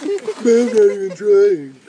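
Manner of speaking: put-on slow, whiny bratty kid brother; sarcastic little brother. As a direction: drawl it like a sulky younger brother. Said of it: "Do the sarcastic little brother voice".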